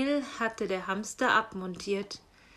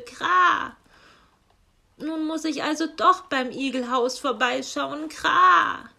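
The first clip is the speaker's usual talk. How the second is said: loud raven voice with harsh caws; croaking raven; 5 semitones higher, 8 dB louder than usual. Acted. Raven postman grumbles to himself, loud and cawing. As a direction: play the grumbling raven squawking Kra.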